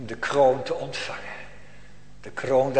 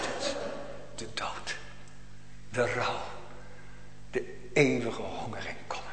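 grave whisper; 5 semitones lower, 10 dB quieter than usual. Acted. Grave warning to the wicked.

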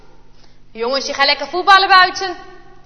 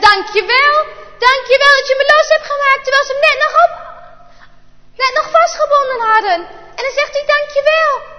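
put-on much higher, sweet little boy; grateful child; 9 semitones higher, 4 dB louder than usual. A narrator's reading. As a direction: thank him with open heart. Jaap thanks his bully Bart. (Jaap bedankt Bart met een hoog kinderstemmetje)

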